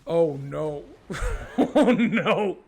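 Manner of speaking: oh no through laughter; laughing mock despair. Real, not acted. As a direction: giggle while crying oh no.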